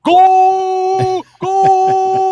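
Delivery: very high, long-held announcer scream; yelled soccer goal call. Shown as a demonstration. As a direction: bellow it out and hold the vowel. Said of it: "Just yell goal randomly".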